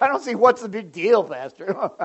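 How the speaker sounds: loud scoffing voice breaking into laughter; mock dismissive. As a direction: imitate someone laughing off the pastor's concern. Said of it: "if you're like".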